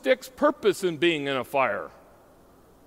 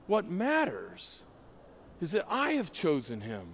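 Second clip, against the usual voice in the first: quiet reassuring voice of God; God's tender reply; 7 dB quieter than usual. Acted. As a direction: speak as God calmly setting the accuser aside. God answering Satan's accusations.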